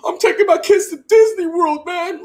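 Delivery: high, loud mock victory speech; Dak Prescott parody. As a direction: ham up a champion's giddy celebration. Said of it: "expecting Dak Prescott to be in the mic saying".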